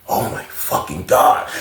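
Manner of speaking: slow, stunned whisper of disbelief; shocked whisper. Retold or imitated. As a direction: breathe it out in horror. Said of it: "looking like".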